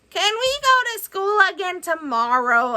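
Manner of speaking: squeaky eager creature asking; hopeful little monster; high monster voice. Acted. Fangface asks Billy to go back to school, high and eager. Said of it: "asked Fangface".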